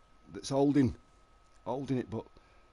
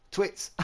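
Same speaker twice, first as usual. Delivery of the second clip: high squealing laugh. Real, not acted. Making faces, high squeal laugh.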